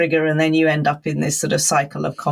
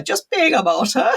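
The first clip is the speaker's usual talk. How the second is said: higher, mock motherly remark; imitating mother; 7 semitones higher than usual. Quoting mother's old saying, imitating her. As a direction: put on mother's voice, amused. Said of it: "My mother always used to go".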